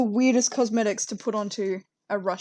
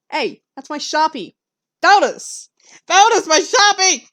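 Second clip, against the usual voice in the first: higher, louder cry at the cat; alarmed call; 6 semitones higher, 8 dB louder than usual. Real, not acted. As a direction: yell after the cat stealing your pen. Cat steals her pen.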